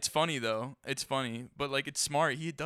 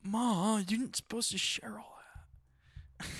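embarrassed whisper; 7 semitones higher than usual. Real, not acted. Whispered aside to mom.

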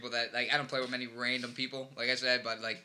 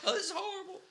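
very high comic wail of dismay; squeaky mock dismay; mock horror; 4 dB quieter than usual. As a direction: play hammed-up disgusted horror. Acts out horrified driver.

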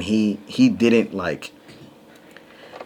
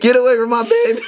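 mock protective shriek; 5 dB louder, faster than usual. Mother defending hurt baby, laughing.

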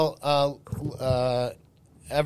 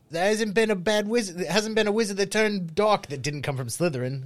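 mock British accent; 8 semitones higher than usual. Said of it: "why he has that accent".

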